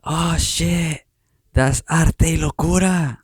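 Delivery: high-pitched surprised shout of recognition; excited yell. Retold or imitated. Kid spots the artist's logo at the art walk, excited yell. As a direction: imitate a kid yelling out in amazement. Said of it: "And he yells out".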